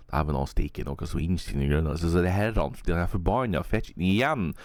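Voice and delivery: fast mock Northern Norwegian gibberish dwarf. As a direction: ham up Northern Norwegian muttering, rising high at the end. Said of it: "something along the lines of".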